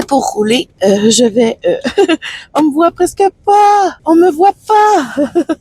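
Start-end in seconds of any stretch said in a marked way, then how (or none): 2.3-5.6 high amused giggly voice; laughing. Real, not acted. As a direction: giggle through the words. Amused at dark car. (en riant)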